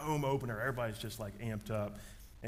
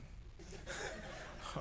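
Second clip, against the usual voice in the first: quiet laugh; 13 dB quieter than usual. Retold or imitated. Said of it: "she's just like".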